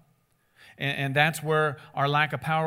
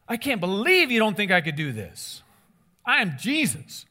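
loud, indignant self-importance; mock offended; 4 semitones higher, 5 dB louder than usual. Preacher acts out the offended Jesus he rejects, indignant. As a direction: puff up in hammed-up affront, higher and louder. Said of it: "He wasn't offended".

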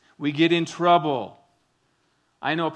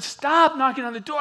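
high, raised voice, losing her patience; exasperated mom; 10 semitones higher, 4 dB louder than usual. Retold or imitated. Mom yelling through the door at her kids, exasperated. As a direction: make them stop knocking.

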